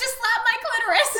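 very high, loud, laughing cry; mock desperate plea. Cohost ends the parody with mock desperate pleading.

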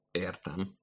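quiet, understated offence; offended. A narrator's reading. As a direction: say it softly, mildly affronted. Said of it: "bólintottam sértetten".

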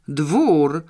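loud, excited reading-out; emotional, emphatic discovery; full of emotion. A narrator's reading. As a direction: announce the word with excitement. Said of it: "pełna emocji".

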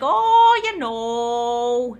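light mock of a mixed Scottish-Southern accent; odd mixed accent. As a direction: mimic a Scottish, Midwestern and Southern blend. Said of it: "the mom's accent is, like, really hard to place".

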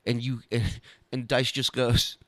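usual speaking voice

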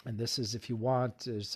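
ordinary talk

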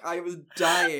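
ordinary talk

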